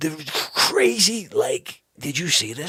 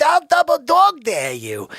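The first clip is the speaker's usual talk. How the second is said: put-on kid's dare; 11 semitones higher, 8 dB louder than usual.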